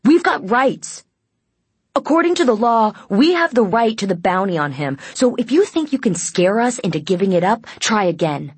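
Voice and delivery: loud, high-pitched protest easing off at the end; defiant shout. A narrator's reading. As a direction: stand up to the guard at full voice. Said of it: "he shouted at the guard".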